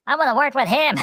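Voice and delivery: high-pitched mock enthusiasm; eager actor. Actor wanting the accent coach, mock eager. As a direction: pitch it up like a keen hopeful.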